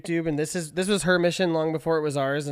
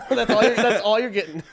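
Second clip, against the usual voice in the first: laughing; 5 semitones higher, 5 dB louder than usual. Real, not acted. Laughing after the impression.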